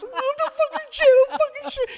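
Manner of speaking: high panicked yell through laughter; high-pitched mock panic, cracking into a laugh. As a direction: relive the terror of the snake on your chest, half laughing. Reenacting panic with snake.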